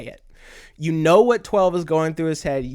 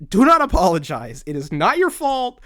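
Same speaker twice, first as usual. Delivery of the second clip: louder and higher, insistent; firm, forceful reassurance; 5 semitones higher, 7 dB louder than usual. Retold or imitated. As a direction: tell her firmly it's not her fault, raising the voice. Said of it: "twelve is like".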